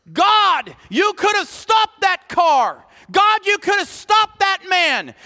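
loud, high accusing cries; acted anguished shouting, hammering every accusation; blaming God. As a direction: hurl each reproach up at God. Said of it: "we question God and we blame God".

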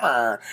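low, soft mock growl; imitated grumpy noise. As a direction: grunt it like a displeased wife. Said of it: "then his wife is like".

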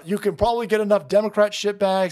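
ordinary delivery

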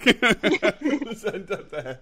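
slow words through laughter; laughing. Real, not acted. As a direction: wrap up while laughing. Laughing wrap-up.

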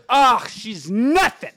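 high, loud, drawn-out mock scorn; pissed-off old lady, imitated. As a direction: spit out her dismissal, slowly. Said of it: "she got pissed".

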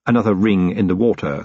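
usual speaking voice

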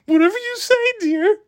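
high, meek, simpering husband voice; meek henpecked husband. Retold or imitated. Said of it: "he starts imitating me".

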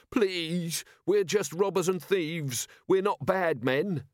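slow, high-pitched pleading; imploring. A narrator's reading. Robber leader on his knees to the captain, imploring. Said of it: "raised his hand imploringly".

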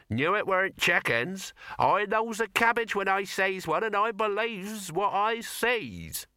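high-pitched country yokel; rustic farmer. A narrator's reading. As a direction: boast like a farmer, slowing at the end.

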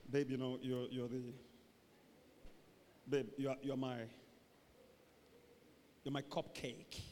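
low, soft, halting murmur; whispering sweetheart. Acted. Lovers close together, murmuring sweet words. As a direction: coo it privately to your babe. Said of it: "two people are just whispering".

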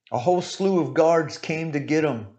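usual speaking voice

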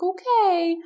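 high, sing-song comforting voice; tipsy friend pats his head and comforts him; soothing drunk friend. Retold or imitated. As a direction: coo at him like a sick child. Friend answering.

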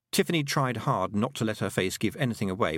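ordinary delivery

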